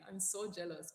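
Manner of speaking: ordinary talk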